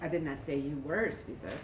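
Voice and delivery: were lifted and stretched; stress on WERE. Shown as a demonstration. Colleague reads line stressing were for the group. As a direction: lean on were, as if correcting. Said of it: "put the emphasis on the highlighted word".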